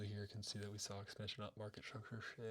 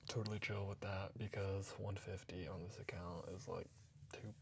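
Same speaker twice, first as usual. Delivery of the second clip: usual speaking voice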